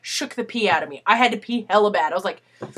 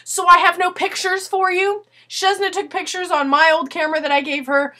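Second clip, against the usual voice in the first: ordinary talk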